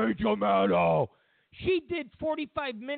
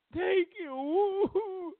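much higher fake crying; mock sob; 12 semitones higher than usual. Putin finishing his apology with a hammed-up sob.

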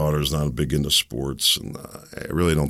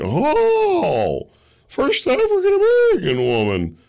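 high, sing-song mock praise, loud; squealing sarcastic cheer; mocking gush; 4 dB louder, slower than usual. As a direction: parody the gushing fans. Mocking the country's reaction.

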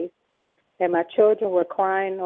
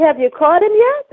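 higher, louder exasperated question; exasperated demand; 11 semitones higher, 10 dB louder than usual. Retold or imitated. Pressing the officers who offer protection, exasperated and loud. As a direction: push back at the police, fed up. Angry retort to police.

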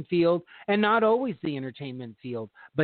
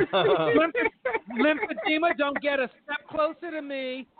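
campy drag queen; 10 semitones higher than usual. Pretend. Drag queen name calling again.

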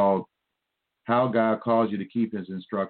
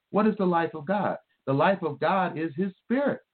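higher-pitched, insistent sermon delivery; fervent preaching; 7 semitones higher than usual. Real, not acted. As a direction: press each point home with question and answer. Preaches on the verse with raised pitch.

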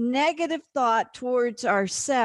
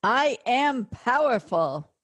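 ordinary talk

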